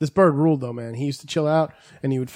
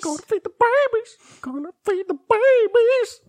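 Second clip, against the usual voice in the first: imitating mother bird. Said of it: "and she's like".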